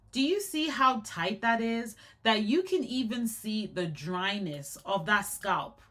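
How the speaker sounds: normal talking voice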